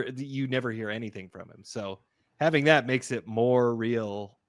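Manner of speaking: ordinary talk, nothing marked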